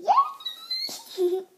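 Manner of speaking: very high squealing yay with a laugh; cheer. Acted. Noah cheers for the baby, squealing high. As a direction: shriek it with delight. Cheering over new sister.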